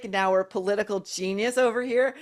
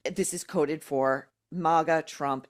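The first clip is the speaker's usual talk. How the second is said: normal talking voice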